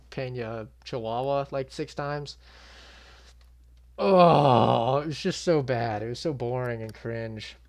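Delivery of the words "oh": long, loud "oh" of exasperation; exasperated groan. Real, not acted. Recalling the cringe press conference, loud exasperated groan. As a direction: groan it out loudly, drawn out.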